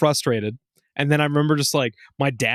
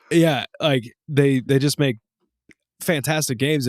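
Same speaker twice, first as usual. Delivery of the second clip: normal talking voice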